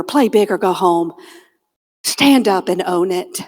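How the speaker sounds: a steady, low inner coach urging her on; encouraging inner voice. Retold or imitated. Her brave inner voice urging her to stand up, low and calm. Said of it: "this other voice is like".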